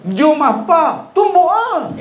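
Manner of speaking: high, needling dare; lightly hammed-up brawler's challenge; mock taunt. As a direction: imitate a hothead daring an old man to fight. Voicing hothead challenging old man.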